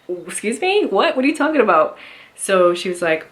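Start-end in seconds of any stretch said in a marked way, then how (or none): 0.1-2.0 incredulous disbelief. Retold or imitated. Woman retelling the nurse's call about heart medicine, high incredulous disbelief. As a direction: replay the moment the news hit you, baffled and alarmed. Nurse calls in surprise prescription.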